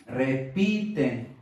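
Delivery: "repiten" said slowly, leaning on "pi"; stress on PI. Shown as a demonstration. Teacher pointing learners to the tonic syllable, stress on "pi" in "repiten". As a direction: draw out the middle syllable "pi" of "repiten". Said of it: "en la sílaba tónica".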